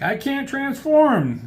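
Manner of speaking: very high, drawn-out whine; hammed-up squeal of distress; mock distressed wail. Acting out helpless character's complaint.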